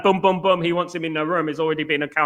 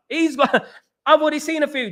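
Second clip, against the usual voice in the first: laughing it out, higher and quicker; laughing repeat; 8 semitones higher than usual. Real, not acted. Streamer bragging to his chat about scouting, laughing. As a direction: giggle through the repeat.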